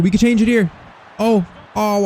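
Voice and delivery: high-pitched burst of excitement; excited. Real, not acted. Gamer watching his team's chance to score, excited. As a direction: pitch leaps up as the chance opens.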